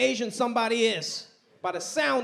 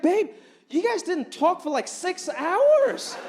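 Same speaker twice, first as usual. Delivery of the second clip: put-on confused girlfriend; 10 semitones higher, slower than usual. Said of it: "She was like".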